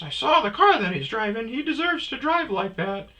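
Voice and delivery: loud, very high lady's voice; imitated lady.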